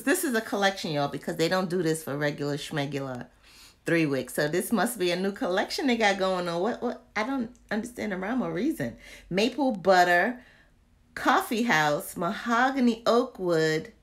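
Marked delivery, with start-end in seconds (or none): none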